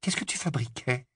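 a suspicious friend demanding answers; softly stern Hermione; stern question. A narrator's reading. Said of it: "demanda Hermione sévère".

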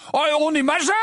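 loud, high protest; cried out. A narrator's reading. Twins protest being sent out, loud and indignant. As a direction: shout the objection together. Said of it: "s'écrièrent Fred et George".

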